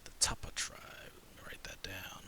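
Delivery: unvoiced whisper to self. Real, not acted. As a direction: breathe it as a private note. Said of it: "whispered note to self".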